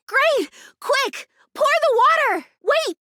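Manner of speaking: urgent excited calls; excited urgency. Acted. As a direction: rush them, then stop short. Rushing the ritual, sudden halt.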